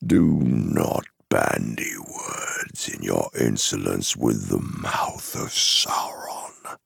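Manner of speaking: low, menacing envoy; haughty dark herald pulling rank; imperious Mouth of Sauron. Acted. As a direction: rebuke insolence in a low, drawn-out voice. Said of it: "he cried".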